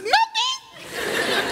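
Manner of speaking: put-on very high, soft teary girl voice; girl's voice. Preacher voicing a crying girl who says nothing's wrong, high and teary. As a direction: squeak out the word like a crying girl. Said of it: "She said".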